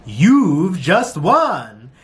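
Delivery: slow, swooping upbeat announcer voice; high-pitched announcer. Retold or imitated. Imitating the prize announcer, high-pitched upbeat voice. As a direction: stretch each word like a prize announcer. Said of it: "very high-pitched, upbeat voice".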